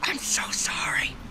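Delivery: slow hushed whisper. Real, not acted. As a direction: breathe the apology, voiceless. Apologizing after sheep dies.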